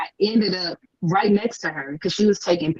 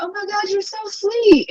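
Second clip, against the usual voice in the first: very high-pitched, adoring squeal of praise; gushing fangirl. Retold or imitated. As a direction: gush in a tiny high voice. Fangirl gushing to star, retold.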